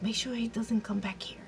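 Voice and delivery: hushed, unvoiced whisper; conspiratorial whisper. [name] whispers orders to Candace, hushed conspiratorial whisper. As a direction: whisper the order so nobody overhears. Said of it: "with the whole French accent".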